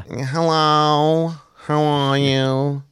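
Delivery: very slow, drawn-out nanny impression; Fran Drescher voice. Host imitating the nanny's greeting, long drawn-out impression. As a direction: stretch each word in a put-on nasal whine. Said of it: "Fran Dresser here".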